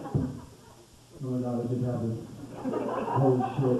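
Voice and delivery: slow, low, soft, dazed gasp of disbelief; hammed-up shock after the surprise hit; stunned victim with a laugh in it.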